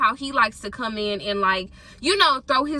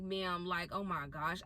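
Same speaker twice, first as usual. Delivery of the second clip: very soft and low, to herself; retold faint, sinking plea; helpless dismay; 6 semitones lower, 16 dB quieter than usual. Traveler to ticket agent about delay, quiet helpless dismay.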